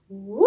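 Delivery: rising woo as the ball flies up; ball whoosh. Shown as a demonstration. Teacher showing the class a ball sound, rising woo. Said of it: "Let's try it together".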